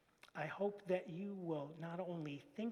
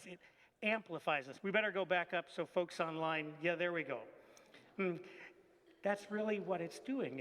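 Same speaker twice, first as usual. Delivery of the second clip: normal talking voice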